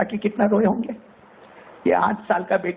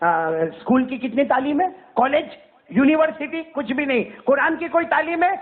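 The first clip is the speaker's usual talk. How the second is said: mock interrogation; 8 semitones higher than usual. Preacher mockingly grills a street boy about his schooling. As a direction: grill the idle boy with contempt. Mock questioning idle youth.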